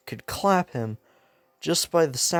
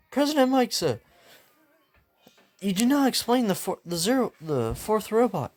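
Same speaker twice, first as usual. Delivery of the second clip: high, formal objection; acted prim, raised-pitch protest; officious complainer; 8 semitones higher than usual. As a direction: interrupt like a stickler student. Voicing Ida's officious complaint.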